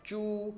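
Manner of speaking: चू high and falling, slow; falling tone zhu. Shown as a demonstration. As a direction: drop चू sharply from high. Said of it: "falling tone के साथ चू". (चू ऊँचे से गिरता टोन)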